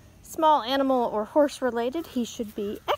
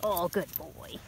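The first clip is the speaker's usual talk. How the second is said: low, much softer murmur of praise; 7 semitones lower, 10 dB quieter than usual. Real, not acted. Owner softly praises her dog up close, low and hushed. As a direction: hush it down to a tender murmur.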